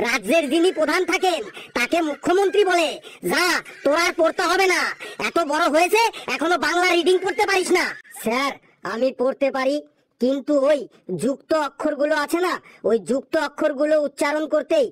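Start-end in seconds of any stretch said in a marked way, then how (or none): none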